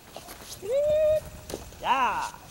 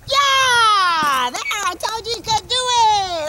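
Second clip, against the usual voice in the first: excited praise; 5 semitones higher, 4 dB louder, slower than usual. Real, not acted. Praising dog after success.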